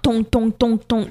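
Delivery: clanging on a pipe, tong tong; imitated pipe clang. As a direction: knock out a metal clang. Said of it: "you hear him banging on the pipe".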